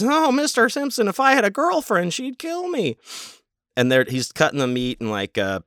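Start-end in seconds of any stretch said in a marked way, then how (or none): 0.0-3.0 very high goofy sitcom-guy voice; Dave Coulier impression. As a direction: squeak it out like a corny eighties trainee. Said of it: "he talks like this".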